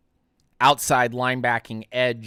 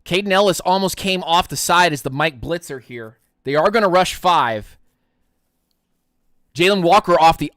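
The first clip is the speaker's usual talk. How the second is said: punchy, louder game call; live play-by-play; 4 semitones higher, 6 dB louder than usual. Real, not acted. Streamer calling a Colts run for viewers, live play-by-play.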